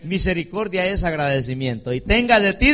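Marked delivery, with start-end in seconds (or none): 1.9-2.7 high, loud preaching; preaching at full force. Acted. Preacher quoting scripture to church, loud fervent. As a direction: ring the verse out.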